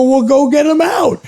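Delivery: frantic order to a friend who is leaving; frantic demand. Retold or imitated. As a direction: push him to go get the snake now.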